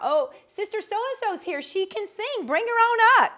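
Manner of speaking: high, lively church call-out; mock pastor call-out. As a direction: imitate the pastor calling her up. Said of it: "when they would call you out".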